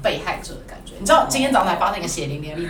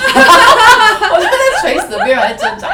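real laugh, louder and higher; laughing, slowed; 14 semitones higher, 11 dB louder, slower than usual. Real, not acted. Wife laughing about fighting her husband's habits, loud and slow. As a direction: laugh it out, dragging the words.

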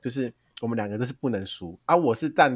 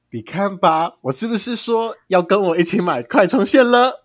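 higher, louder, gloating I-told-you-so tone; smug told-you-so; 8 semitones higher, 7 dB louder, slower than usual. Retold or imitated. Older sister gloating at brother over broken charging cable. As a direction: rub it in that he should have listened. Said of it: "我就用这个语调跟我弟讲话".

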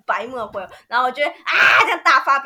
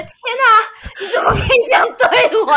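high, loud, half-crying wail through laughter; hammed-up tearful outrage; mock whiny sob; 10 semitones higher, 10 dB louder than usual. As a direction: wail it like a wronged child. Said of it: "然后就会开始想要哭".

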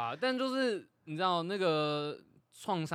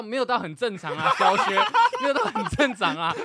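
laughing; 7 semitones higher, 10 dB louder than usual. Real, not acted. Laughing through the story.

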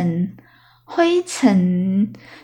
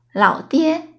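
normal talking voice

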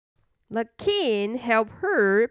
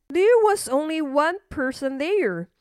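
plain reading voice

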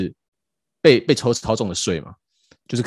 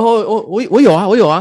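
flustered stammer; 10 semitones higher, 6 dB louder than usual. Retold or imitated. Flustered admitting owning Bitcoin.

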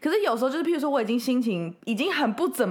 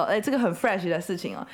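ordinary delivery

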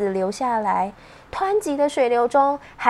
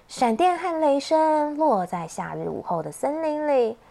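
ordinary delivery, nothing marked

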